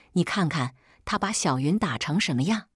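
ordinary delivery, nothing marked

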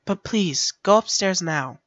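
soft, slow motherly plea; gentle goat mother. Acted. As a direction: coax the child softly to go upstairs. Voices Toriel, Undertale goat mother.

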